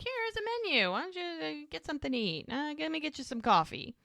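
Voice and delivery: high, over-bright mock hospitality; changing the subject. Barman steers sheriff away from the case, high-pitched deflecting offer. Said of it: "change the subject".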